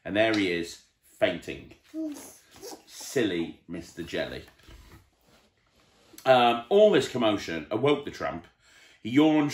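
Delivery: plain reading voice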